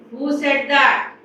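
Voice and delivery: loud, drawn-out angry demand. A narrator's reading. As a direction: demand it loudly as an outraged king. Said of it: "Very angrily he said".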